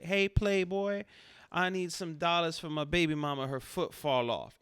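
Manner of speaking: hammed-up street hustler pitch. Shown as a demonstration. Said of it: "you say".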